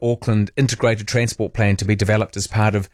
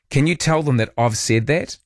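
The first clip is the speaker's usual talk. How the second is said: ordinary talk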